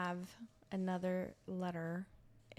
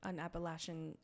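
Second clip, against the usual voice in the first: ordinary delivery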